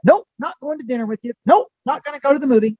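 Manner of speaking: quick, high, dismissive nopes; sassy refusal. Acted. Aquarius cancelling plans on someone, sassy and dismissive.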